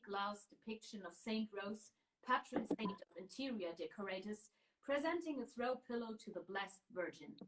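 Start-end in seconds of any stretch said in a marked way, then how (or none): none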